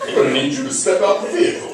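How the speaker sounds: high, official mock police order; traffic cop. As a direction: order the driver out as a put-on cop. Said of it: "the cops pull you over".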